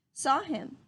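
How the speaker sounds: ordinary delivery, nothing marked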